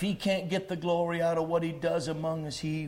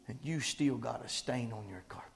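whisper; 6 semitones lower, 5 dB quieter than usual. Acted. Storytelling, dropped voice.

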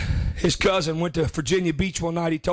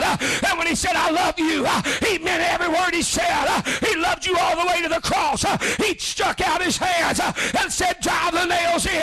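fiery preaching; 8 semitones higher than usual. Real, not acted. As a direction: preach it hard, with a huff after each line. Builds back into preaching.

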